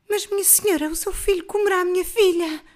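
put-on high, loud alarmed old mother; mãe aflita. A narrator's reading. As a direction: cry out in a frightened protest. Poor mother protests to queen. (velha mãe aflita, aguda e alta)

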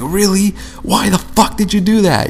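pitched high, disbelieving, at the other driver; really, why would you do that; exasperated high-pitched disbelief at a careless driver; incredulous outburst. Retold or imitated.

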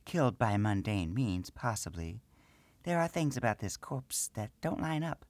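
high small gnome woman's voice, matter-of-fact; gnome priestess. Acted. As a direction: speak as the little priestess.

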